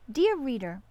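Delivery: plain reading voice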